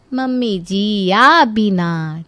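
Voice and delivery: very slow, drawn-out whine of protest; lingering, mock-reproachful drawl; coaxing protest. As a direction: stretch each word cajolingly. Daughter-in-law coaxes mother-in-law.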